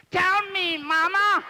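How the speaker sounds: high loud shout; loud call. Real, not acted.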